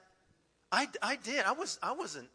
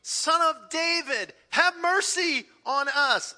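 loud, slow desperate plea; crying out; 9 semitones higher, 10 dB louder, slower than usual. Retold or imitated. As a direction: call out to Jesus for mercy from afar. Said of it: "they cried out".

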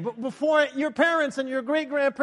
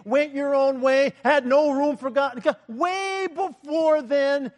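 slow preaching climax; 4 semitones higher, slower than usual. Real, not acted. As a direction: stretch each phrase to the peak.

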